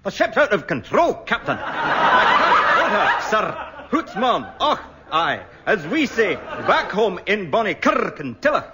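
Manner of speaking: hammed-up Scottish engineer; rolled R's, broad Scottish accent; gruff scottish lord. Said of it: "you can tell by the way he rolls his R's".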